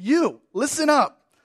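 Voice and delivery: tough-love pastor calling you, listen up; emphatic call. Retold or imitated. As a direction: shoot straight at the people. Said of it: "And with his emphasis".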